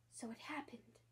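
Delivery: breathy hushed resignation; sad whisper. Acted. Starling tells Mary Poppins softly the babies forgot. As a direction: let it sink quietly.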